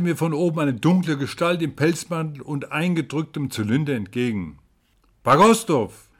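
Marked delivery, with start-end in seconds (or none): 5.2-6.1 loud, high call of a name; startled, raised-voice exclamation; exclaiming. A narrator's reading. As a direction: cry out on recognizing a friend. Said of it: "rief ich aus".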